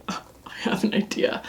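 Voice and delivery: quiet unvoiced whisper; hushed, whispered aside. Real, not acted. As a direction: whisper it like a secret idea. Hushed first take, then repeated aloud.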